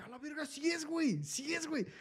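high, awed disbelief; seeing the wrestler from the bus, amazed; amazed recognition. Retold or imitated. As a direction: gasp it like you can't believe your eyes. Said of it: "Y yo cuando volteé dije".

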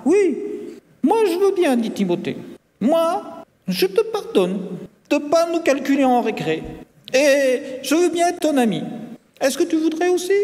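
put-on very high, friendly child voice; forgiving classmate. A narrator's reading. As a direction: offer friendship in a high little voice.

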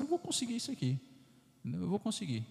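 a quiet confident promise to oneself; hopeful resolve. Retold or imitated. Priest voicing hope, mildly confident.

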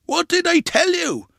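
high, loud retort; scolding exclamation. A narrator's reading. Grandpa proven right about nightmares, loud scolding. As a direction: crow that you were right.

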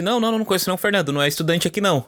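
high, fast flustered denial; 1 panicked owner denying. Retold or imitated. School owner on the phone to immigration, high panicked denial.

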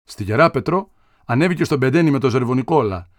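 ordinary delivery

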